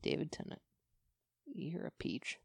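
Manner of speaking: very soft, almost whispered; hushed fond aside. Acted. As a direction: murmur it adoringly to the actor. Fan's fond aside to the actor.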